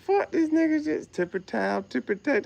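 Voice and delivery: incredulous high squeal. Retold or imitated. Retelling his reaction to weird slang, high incredulous squeal. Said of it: "I was like".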